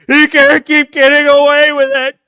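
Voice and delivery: very loud, high anguished yell; Jesse Pinkman. Acted. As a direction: wail it at full volume in outrage. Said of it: "gotta do the, the, the Jesse Pinkman".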